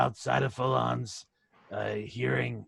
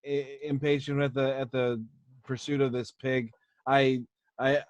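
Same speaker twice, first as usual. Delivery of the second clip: ordinary delivery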